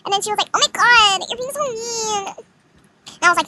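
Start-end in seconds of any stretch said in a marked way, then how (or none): 0.5-2.3 high, drawn-out mock whine; whiny mimicry. Arrogant girl complains after being told off, high mocking whine.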